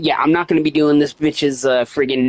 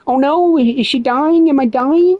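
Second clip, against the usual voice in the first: mock panic; 12 semitones higher, 4 dB louder than usual. Mock panic over dying keeper.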